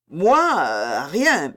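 flustered, hesitant stammer. A narrator's reading. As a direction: stumble for an answer, caught out. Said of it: "balbutia monsieur".

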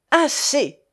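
high, abrupt command to stop; sharp protest. A narrator's reading. Young man pushing a hand from his chest, sharp protest. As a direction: cut him off sharply, pitched high. Pushes the man's hand away.

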